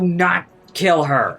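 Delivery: retold plea. Spider-Man warning Punisher not to kill her, loud and slow. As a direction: plead it loudly, word by word. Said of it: "Spider-Man's like".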